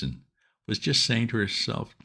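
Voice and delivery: ordinary delivery, nothing marked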